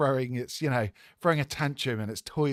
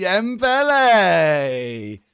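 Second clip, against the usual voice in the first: drawn-out, slow, louder presenter call; announcer intro; 4 semitones higher, 9 dB louder, much slower than usual. Acted. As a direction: announce the guest like a ring announcer. Introducing the guest on air.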